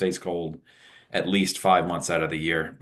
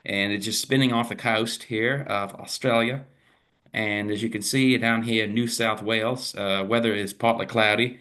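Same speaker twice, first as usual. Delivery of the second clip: put-on Aussie accent, pitched a little up; Australian accent; 2 semitones higher than usual. Weather forecaster playfully reporting from down under, put-on Australian accent. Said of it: "changing to Australian accent".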